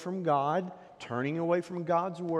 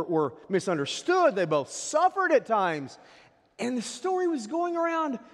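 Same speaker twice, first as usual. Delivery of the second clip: raised, impassioned preacher voice; higher and louder pulpit delivery; fervent preaching; 10 semitones higher, 7 dB louder than usual. Acted. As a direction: preach it out big to the congregation. Preaching at full force.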